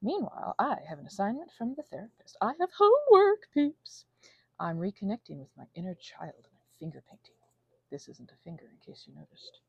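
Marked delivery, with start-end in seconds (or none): 2.4-4.0 high, loud, excited announcement; excited squeal. Real, not acted. Telling viewers about therapist homework, high and excited. 7.9-9.6 soft, then breathy whisper; whispered aside. Real, not acted. Aside to viewers about the brush, fading to a whisper.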